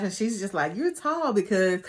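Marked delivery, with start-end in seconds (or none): 0.7-1.3 high-pitched mock nervous blurt; imitated nervous remark. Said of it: "gets this nervous laugh".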